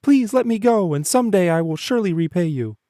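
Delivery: tiny frightened mouse; timid mouse pleading. A narrator's reading. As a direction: squeak out a desperate promise. Mouse voice in story.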